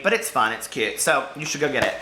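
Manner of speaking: ordinary delivery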